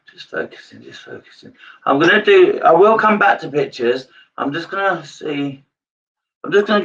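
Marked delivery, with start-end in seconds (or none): 0.1-1.5 whispered, much softer self-reminder; murmured to herself. Real, not acted. Medium concentrating on spirits, whispering softly to herself. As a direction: drop to a whisper as you concentrate.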